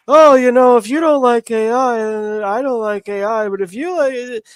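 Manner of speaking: high, loud mocking imitation; mocking whiny voice. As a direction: parody a preachy hand-wringer. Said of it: "who was all fucking".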